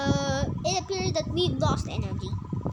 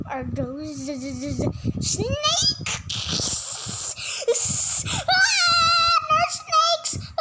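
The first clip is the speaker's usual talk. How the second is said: scared; 10 semitones higher, slower than usual. Acted. Scared of snakes.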